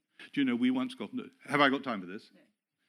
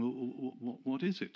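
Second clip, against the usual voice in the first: halting, stammered nervous question; nervous and tentative; 8 dB quieter than usual. Retold or imitated. Pastor nervously asking the visitor what is wrong, timid stammer. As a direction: ask it timidly, tripping over the start. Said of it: "very nervously and tentatively".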